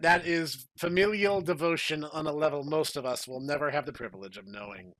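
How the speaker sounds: normal talking voice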